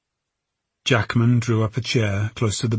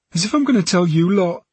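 high-voiced, defiant girl; 9 semitones higher, 4 dB louder than usual. A narrator's reading. Girl refuses to tell the police, defiant. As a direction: throw it back at them defiantly. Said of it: "Her defiance returned".

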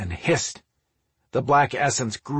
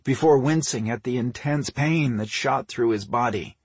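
plain reading voice

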